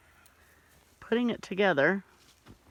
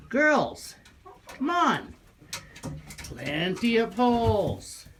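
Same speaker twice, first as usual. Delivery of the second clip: calling out; 3 semitones higher, slower than usual. Real, not acted. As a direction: call the chickens over. Calling the hens out.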